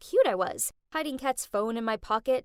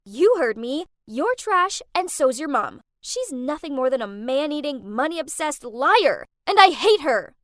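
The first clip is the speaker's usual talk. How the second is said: loud, hateful rant; furious yelling; 2 semitones higher, 9 dB louder than usual. Acted. Still screaming at Kath about her mother, enraged. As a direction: hammer each insult home. Shouting on, same phone quarrel.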